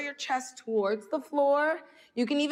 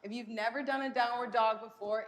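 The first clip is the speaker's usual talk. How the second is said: ordinary delivery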